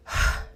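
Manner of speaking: audible open-mouth sigh; exhaled sigh. Shown as a demonstration. Teacher demonstrating the sigh breath, exhaled sigh. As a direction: breathe out in one airy sigh. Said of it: "exhale through the mouth with a sigh".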